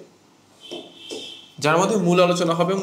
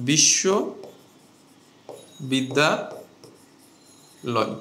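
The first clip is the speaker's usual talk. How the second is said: বিশ্ববিদ্যালয় in three chunks, বিশ্ব, বিদ্যা, লয়, with long pauses between; broken into parts; 2 semitones lower, much slower than usual. Shown as a demonstration. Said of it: "এইভাবে ভেঙে ভেঙে যখন আমি উচ্চারণ করছি".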